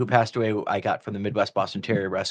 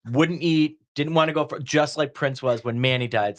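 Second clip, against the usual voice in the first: ordinary talk, nothing marked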